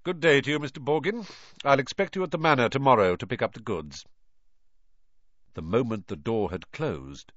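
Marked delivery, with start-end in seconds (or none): 0.0-4.0 brisk, higher aristocrat's goodbye; dismissive nobleman; haughty farewell. A narrator's reading. Malfoy farewell.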